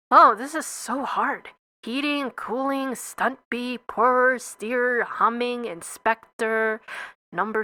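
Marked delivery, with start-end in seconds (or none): none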